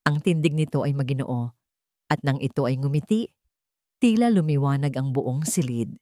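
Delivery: ordinary delivery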